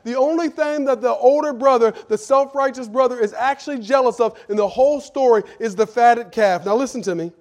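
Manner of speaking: loud, impassioned sermon delivery; fiery preaching. Acted. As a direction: preach it out full force to the congregation. Preaching at full force.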